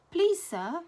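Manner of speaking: high, loud whiny plea; a bully faking hurt to the teacher; wailing. A narrator's reading. Bully whining to the teacher, high and loud. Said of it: "wailed Brendan the bully".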